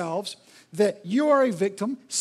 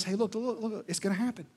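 soft, low reassuring voice; calm them like a kind teacher; Jesus quietly reassuring followers, much softer; gentle Jesus; 6 semitones lower, 9 dB quieter than usual. Retold or imitated. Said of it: "Which is why Jesus says".

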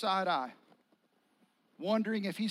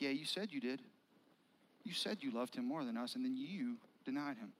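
quiet reproach; 6 semitones lower, 6 dB quieter than usual. Retold or imitated. Disciples' silent reproach voiced.

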